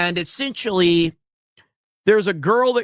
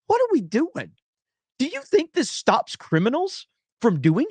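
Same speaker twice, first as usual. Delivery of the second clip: exasperated; 7 semitones higher than usual. Real, not acted. Peak of exasperated rant.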